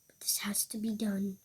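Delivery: unvoiced grim whisper. Character burying his cat, grim whisper. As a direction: whisper it like a hard duty.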